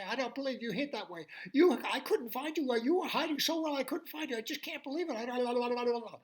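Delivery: high, fast little fish babble; rapid high chattering fish ending in la la. Acted. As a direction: spill it all out quick and high. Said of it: "in his very fast voice".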